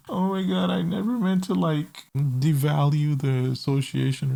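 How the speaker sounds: high-pitched imitation of tearful pleading; mock crying. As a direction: whine it like a mock crybaby apology. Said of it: "And then she starts crying".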